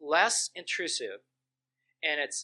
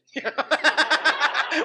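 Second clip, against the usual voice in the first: high loud laugh; 13 semitones higher, 11 dB louder than usual. Real, not acted. Mocking own church's reaction.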